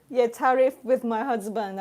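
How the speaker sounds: usual speaking voice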